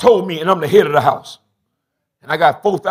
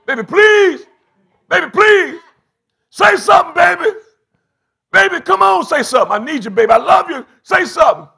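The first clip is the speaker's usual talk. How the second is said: put-on high, loud frantic calls of baby; desperate hollering; 10 semitones higher, 5 dB louder than usual. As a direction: holler for her like she's slipping away. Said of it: "he hollering for her".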